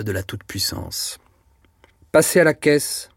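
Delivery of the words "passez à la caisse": higher, louder dismissal; ringing pronouncement. A narrator's reading. Head saleswoman dismissing Denise, ringing out loud. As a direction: let the sentence ring out sternly. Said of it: "La terrible phrase sonna très haut".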